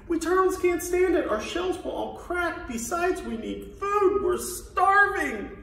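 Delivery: very high, pleading little turtle; groaning complaint. Acted. Plain little turtle begs the king, groaning. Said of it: "groaned Mac".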